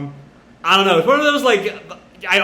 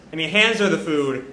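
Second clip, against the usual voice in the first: ordinary talk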